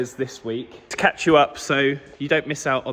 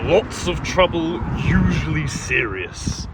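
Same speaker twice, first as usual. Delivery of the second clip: quoting Clarkson; slower than usual. Retold or imitated. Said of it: "Quoted from?".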